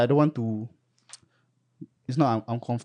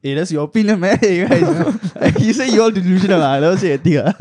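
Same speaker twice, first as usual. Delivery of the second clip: louder, higher, laughing; laughing disclaimer; 6 semitones higher, 7 dB louder than usual. Pretend. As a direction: laugh while backing away. Jokingly disowning the opinion.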